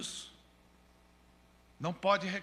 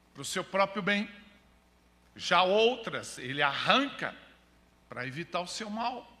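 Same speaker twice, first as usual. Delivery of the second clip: pregar alto e pausado; pregação enfática; 4 dB louder than usual. Real, not acted. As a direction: proclamar à igreja, esticando palavras-chave. (pregação forte e pausada)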